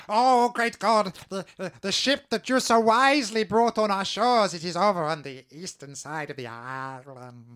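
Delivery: high, loud awed creature voice; groveling creature. Acted. Fish creature groveling to the player's fake god, high and awed. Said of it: "And he says".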